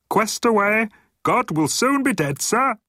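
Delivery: very high-pitched underling voice; honking gunner. A narrator's reading. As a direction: honk the report down a voice tube, high and eager. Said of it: "honked down a voice tube".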